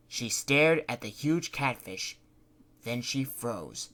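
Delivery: ordinary delivery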